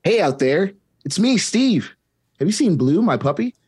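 put-on bright, high children's TV host voice; cheery kids' show host. Steve greeting the viewers in a cheery host voice. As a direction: beam at the kids at home. Steve role in table read.

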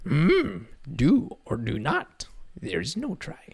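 lightly voiced wise old Yoda; Yoda impression. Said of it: "Like Yoda".

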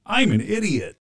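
high-pitched self-mocking drop; high, swooping performed one-liner; catchphrase drop. Shown as a demonstration. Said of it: "Send the "I'm an idiot" as a drop".

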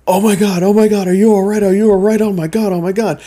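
high, loud, panicked concern; worried stepbrother. Retold or imitated. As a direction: rush it like a scared big brother. Stepbrother runs over, worried.

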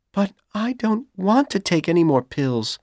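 put-on young woman's high, nervous plea; a girl pleading, anxious and high; Dorothy nervously refusing her boyfriend. A narrator's reading. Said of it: "Dorothy said nervously".

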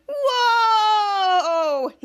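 high, loud gasp of wonder; a big awestruck whoa; amazed whoa. Acted. Kids amazed again.

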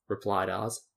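ordinary delivery

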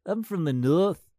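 slow, high, loud "I'm from the North" with a rising end; northern English accent. Retold or imitated. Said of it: "that's what they all sound like".

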